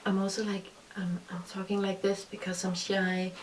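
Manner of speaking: hushed imitation of a shy younger self; quiet, shy. Vlogger showing how she spoke in old videos, quiet and shy. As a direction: drop the voice very soft, as if shy. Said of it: "I speak very, like, quiet".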